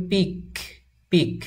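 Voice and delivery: পিক ending in a crisp released k; teacher contrasting পিক with a hard final k; voiceless final k. Shown as a demonstration. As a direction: say পিক with k, not g. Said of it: "দুইটি উচ্চারণের মধ্যে".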